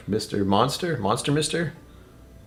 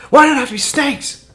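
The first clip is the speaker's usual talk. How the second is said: high, loud cry of dread; mock movie-hero complaint; 8 dB louder than usual. Man quoting an adventure film about snakes, high loud mock complaint.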